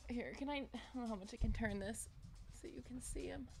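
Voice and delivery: ordinary delivery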